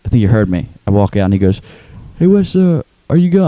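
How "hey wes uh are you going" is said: high, hesitant mimicry; put-on high voice, halting question; Tim impression. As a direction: imitate a pestering neighbour's greeting. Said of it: "he talks like this".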